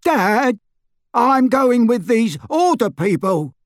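high, slow, firm announcement; loud declaration. A narrator's reading. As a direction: declare it loudly to father. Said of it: "said Dudley in a loud voice".

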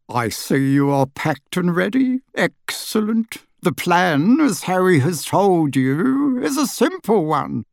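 high, slow, fawning bow voice; a little wizard bowing deeply; fussy little wizard. A narrator's reading. Daedalus explaining plan.

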